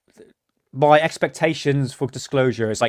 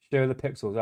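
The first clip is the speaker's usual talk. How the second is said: "Show the pixels" softer and quick, as an aside; soft repeat; 8 dB quieter than usual. Shown as a demonstration. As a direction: mutter it back in agreement.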